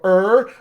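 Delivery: "er" with tongue pulled up and back; a strong American r on "er"; "er" with a braced, retroflex r; American bunched r. Shown as a demonstration. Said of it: "brace against my teeth to go".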